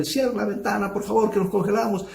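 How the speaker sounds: pitched high, pleading to shut the window; mock shouting. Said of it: "Y otros gritando".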